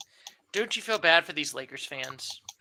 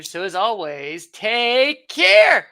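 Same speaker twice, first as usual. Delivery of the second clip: big sign-off; 4 semitones higher, 8 dB louder, much slower than usual. Acted. Podcast sign-off.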